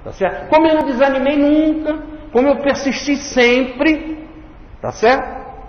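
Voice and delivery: high, emphatic urging. Retold or imitated. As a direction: rise up and urge them to follow. Voicing Jesus, urging to follow.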